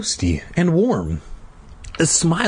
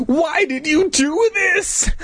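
high, drawn-out angry demand; cry of rage; slower than usual. A narrator's reading. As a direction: wail the accusation in rage. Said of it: "With another cry of rage".

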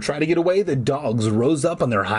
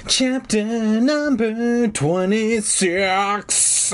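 high, very slow, stretched heading; hammed-up announcement; 9 semitones higher, much slower than usual. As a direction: drag out every word up high.

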